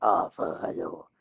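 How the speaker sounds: low, soft half-hearted impression; Tom Hardy impression. Trying not to laugh, low Tom Hardy voice. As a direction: sink into a quiet put-on greeting. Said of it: "I'm trying not to laugh".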